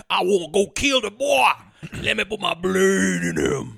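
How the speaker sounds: slow, hammed-up Southern mobster accent; Southern Italian mobster. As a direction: drag out a cartoon Southern-Italian gangster accent. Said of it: "they are Southern Italian mobsters".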